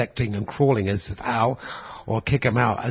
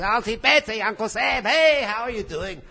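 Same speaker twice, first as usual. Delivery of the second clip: much higher, bright hello to the family; higher excited hello; cheery greeting; 9 semitones higher than usual. Retold or imitated. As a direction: call out to the relatives, delighted. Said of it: "So I see all my friends".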